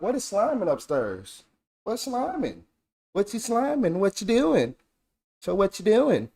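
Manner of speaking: put-on high, soft baby talk; baby talk to a kitten. Cooing at a kitten found upstairs. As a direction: coo at the kitten in a tiny voice.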